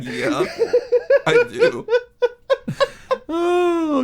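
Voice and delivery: very high, laughing squeak. Real, not acted. Retelling his reply, laughing.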